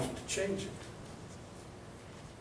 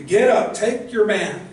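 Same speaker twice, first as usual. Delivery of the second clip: forceful command; 4 semitones higher, 5 dB louder, slower than usual. Shown as a demonstration. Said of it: "That's the force of the language".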